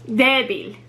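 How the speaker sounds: "débil" with DÉ stressed, BIL falling away; stress on first syllable. Shown as a demonstration. Teacher repeating for learners, stress on DÉ in "débil". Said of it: "stress is on the first syllable".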